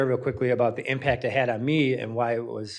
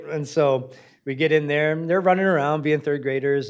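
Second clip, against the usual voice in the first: ordinary talk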